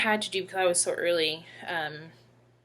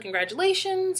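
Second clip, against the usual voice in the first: high, bright burst of cheer, imitating the nurse; excited congratulations; 13 semitones higher, 4 dB louder than usual. Nurse congratulating her patient, imitated with high excitement. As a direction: beam it out like a thrilled nurse. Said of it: "she was exciting".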